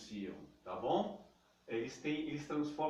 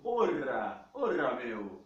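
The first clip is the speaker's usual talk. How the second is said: a loud paulistano "orra, meu" with a tapped R; a São Paulo city guy saying "orra, meu"; 3 semitones lower, 5 dB louder, much slower than usual. Retold or imitated. Teacher imitates a paulistano for students, lightly. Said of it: "Seria o R do".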